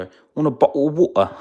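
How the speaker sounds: high, loud, quick mock-British; exaggerated British accent, pitched right up. Shown as a demonstration. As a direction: ham up the British accent, fast. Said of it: "if I wanna be more British".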